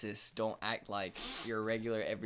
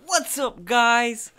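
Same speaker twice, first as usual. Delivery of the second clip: hyped intro; 11 semitones higher, 17 dB louder, much slower than usual. Acted. Another mock intro greeting.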